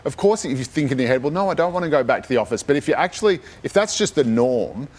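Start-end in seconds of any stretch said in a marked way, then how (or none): none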